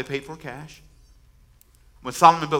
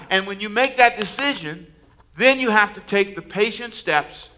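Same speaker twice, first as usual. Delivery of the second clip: building preaching; 4 semitones higher, 5 dB louder than usual. Real, not acted. Building sermon climax.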